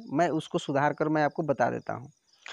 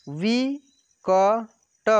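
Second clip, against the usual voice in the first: ना and रा stretched apart, louder; very slow syllables; 4 semitones higher, 4 dB louder, much slower than usual. Shown as a demonstration. Teacher sounding out syllables for pupils, very slow. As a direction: spell it out slowly, syllable by syllable. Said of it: "direct आपको बोलना है कि".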